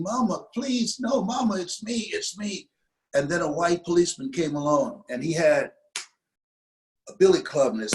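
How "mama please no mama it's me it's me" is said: put-on high, pleading child's cry of "Mama"; a five-year-old begging his mother to stop; retold child's scream. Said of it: "And I was screaming".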